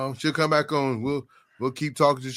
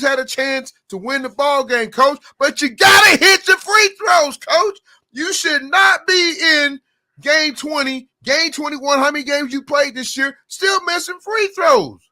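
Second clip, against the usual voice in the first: much higher and louder, near shouting; fired-up rant; 9 semitones higher, 5 dB louder than usual. Real, not acted. Coach ranting at players who miss free throws, shouting. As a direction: preach it hard like an angry coach.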